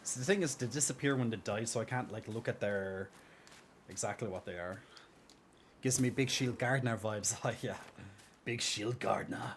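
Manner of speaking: ordinary talk, nothing marked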